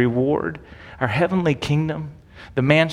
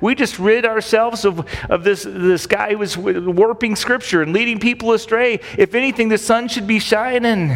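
higher, self-satisfied mock voice of the priests; smug Pharisees; 6 semitones higher than usual. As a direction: play them patting their own backs. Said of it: "they're thinking".

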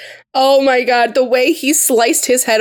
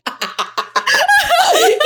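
high shrieking laugh. Real, not acted.

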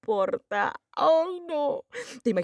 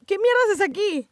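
higher, sharp startled question; 9 semitones higher than usual. Real, not acted. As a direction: react to someone barging in. Startled by intruder in mind.

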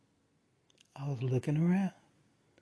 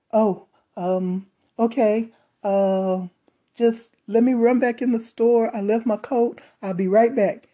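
higher, louder, hesitant then rushing to get away; rattled coworker; 6 semitones higher, 7 dB louder than usual. Retold or imitated. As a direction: act out a nervous excuse to escape. Rattled coworker makes excuse to flee.

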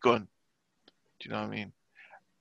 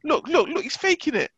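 much higher, whiny impression of wife; high mock wife voice; 10 semitones higher than usual. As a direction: mimic her insisting on a fake. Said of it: "She's like".

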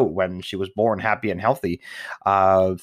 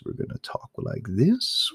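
a lightly lowered, softer, slow cool-guy voice; 8 semitones higher, 4 dB quieter than usual. Shown as a demonstration. New dad to viewers, demoing his cool Friday voice. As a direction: lean in and play it cool and slow. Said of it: "do our cool voice".

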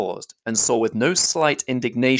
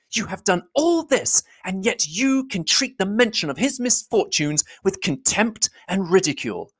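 higher, forceful accusing voice; Elizabeth crying out with energy; 11 semitones higher than usual. Acted. Elizabeth crying out at Darcy, higher and forceful. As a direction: fling the charge at him, higher and forceful. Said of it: "cried Elizabeth with energy".

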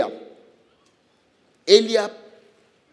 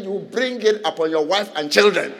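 usual speaking voice

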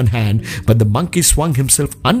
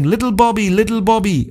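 calling out; 9 semitones higher than usual. Acted. People calling in dream.

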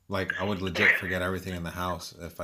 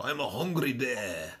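slow, higher mock Siberian accent; 6 semitones higher, slower than usual. Joking about a friend's nickname Bear, mock Siberian accent. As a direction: draw it out in a put-on Siberian accent. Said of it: "activate your Siberian accent and go".

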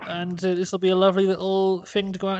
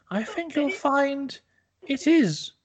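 smug retort; 8 semitones higher, slower than usual. Acted. Amanda Holden smug retort.

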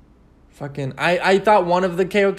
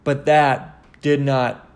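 usual speaking voice

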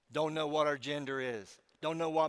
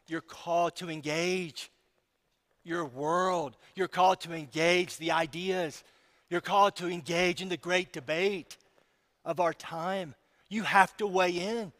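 preaching; 3 semitones higher, 7 dB louder than usual. Acted. Building exhortation to the church.

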